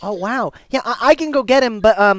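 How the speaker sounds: put-on high, loud little-boy voice; helpful young boy. Boy answering stranger about Grandpa, young and helpful. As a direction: answer as an eager kid.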